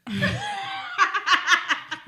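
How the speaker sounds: real burst of shrieking laughter; high squealing laugh. Real, not acted. Friends reacting to a cheeky question, squealing laughter. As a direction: crack up in high peals.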